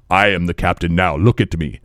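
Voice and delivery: low, stern imitation; deadly serious. Imitating a drunk African man turned suddenly stern, low and deadly serious. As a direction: imitate a stare straight into the soul. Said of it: "to very stern and, like, staring straight into your soul and being deadly serious".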